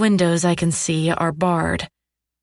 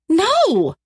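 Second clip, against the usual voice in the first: high, forceful yell of refusal; 11 semitones higher, 4 dB louder than usual. A narrator's reading. Captive refusing her captor's rules, yelling. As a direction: yell it in outrage. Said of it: "I yell".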